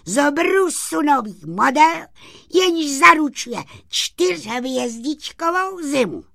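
high, loud pitchman voice for a little sprite; sprite's proud, high-pitched sales patter; boastful little sprite. Acted. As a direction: show off your invention in a high, ringing voice. Story figure pitching his invention.

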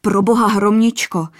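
king shielding the maiden, terrified; terrified blurt. A narrator's reading. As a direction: blurt it out in terror. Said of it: "vyhrkl zděšeně".

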